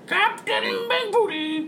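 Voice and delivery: slow, squawked parrot call. Acted. Pickles the parrot calls the captain, squawking. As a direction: screech it out like a pet bird. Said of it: "Pickles the parrot".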